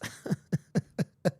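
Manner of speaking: short run of chuckles; chuckling. Real, not acted. Host amused by his own Family Guy reference, chuckling. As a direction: laugh softly at your own joke.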